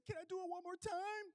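high, sweet asking voice; high gentle nurse voice; mock nurse voice.

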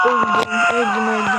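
first öldüm shouted very high and loud; anguished shout. Real, not acted. Gamer shouting as his character dies, anguished and high.

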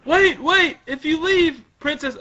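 high, loud, urgent character voice; pleading guard. Acted. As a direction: call them back urgently. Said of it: "They're like".

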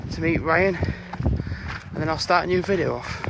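normal talking voice

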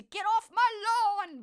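loud, high, unhinged shout of the line; crazed Clint Eastwood. Retold or imitated. Impressing Clint Eastwood's line for viewers, loud and crazed. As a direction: yell it like a madman. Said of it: "why am I making him sound crazy".

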